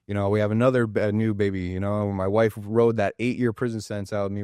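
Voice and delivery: normal talking voice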